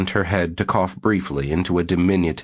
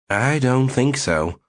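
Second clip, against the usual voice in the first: slow, steady, unbending; flatly refuse, calm and firm; level but firm; 6 semitones higher, slower than usual. Acted. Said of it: "in a level but firm voice".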